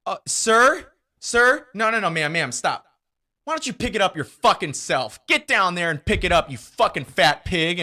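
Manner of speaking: loud, high, indignant outburst; acted furious rant, building to insults; imagined angry telling-off. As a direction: chew someone out at full volume.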